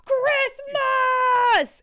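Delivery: high, loud festive cry; excited shout. Real, not acted. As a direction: belt out the holiday cheer. Festive shout starting the segment.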